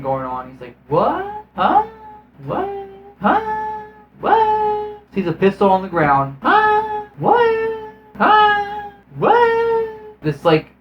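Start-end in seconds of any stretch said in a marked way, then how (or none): 0.9-5.0 very high, rising squeals of confusion; hammed-up bewildered yelps, each shooting upward; mock bafflement. Mimics Smith's baffled reaction. 6.4-10.2 very high, rising squeals of confusion; hammed-up bewildered yelps, each shooting upward; mock bafflement. Mimics Smith's baffled reaction.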